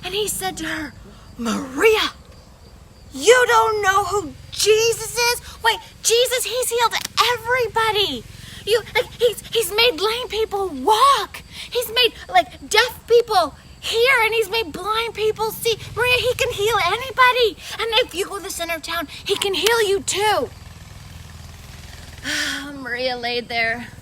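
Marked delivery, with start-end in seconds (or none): 1.4-20.5 much higher and louder, amazed; can't believe she hasn't heard; astonished kid. Acted. Kid amazed, telling Maria all about Jesus. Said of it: "his eyes got as big as saucers". 20.6-22.7 heavy sigh. Acted. Sick woman lying in bed.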